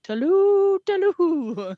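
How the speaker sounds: high, loud hunting call "Tallu, tallu"; host imitating a yell from a fox hunt movie. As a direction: holler like someone hunting the fox. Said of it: "somebody yells".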